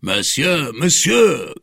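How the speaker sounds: high, loud cry after someone; calling out. Acted. Hostler running after the stranger, calling out. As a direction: holler after him. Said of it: "cried a hostler".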